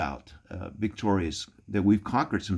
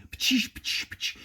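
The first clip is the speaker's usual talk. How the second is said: voiceless hissing pops like beer cans cracking open; can-opening imitation. Mimicking beer cans popping open during the funeral, voiceless hiss. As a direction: spit out the pfft of cans opening.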